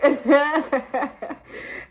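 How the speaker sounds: reenacted nervous stoned laugh; scared laughing. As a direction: giggle helplessly as if high and scared. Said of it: "I'm laughing, but, like, I'm really scared".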